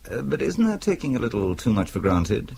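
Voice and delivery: normal talking voice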